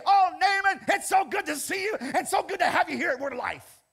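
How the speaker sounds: loud, high, gushing mock welcome at the door; a shouted, overdone church greeting; mock warm welcome. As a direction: ham up an overjoyed pastor greeting. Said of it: "He didn't say".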